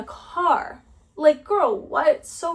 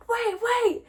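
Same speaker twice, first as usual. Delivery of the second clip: imitated calling out; 11 semitones higher than usual. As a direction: mimic someone hailing a passing cyclist. Man calls out as she passes.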